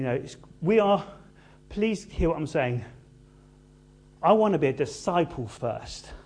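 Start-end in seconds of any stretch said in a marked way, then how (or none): none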